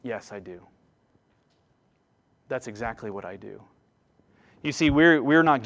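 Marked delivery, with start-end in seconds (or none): 0.0-3.6 much softer and lower, calm assurance; God's gentle reply. Acted. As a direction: answer like a patient father, hushed. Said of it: "we hear God reply".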